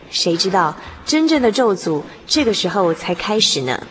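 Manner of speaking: ordinary talk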